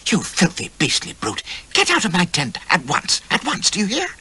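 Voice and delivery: high furious lady's voice; angry hiss. A narrator's reading. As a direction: spit out the insult. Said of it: "she hissed".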